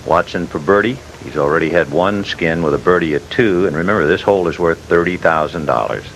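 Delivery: ordinary talk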